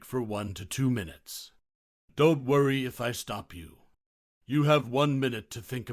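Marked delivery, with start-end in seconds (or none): none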